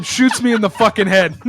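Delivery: ordinary talk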